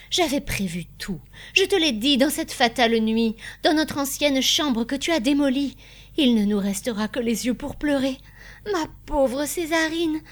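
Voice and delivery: distraught, voice rising towards tears; despairing lament. A narrator's reading. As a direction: lament the ruin you foresaw. Appalled wife laments their ruin.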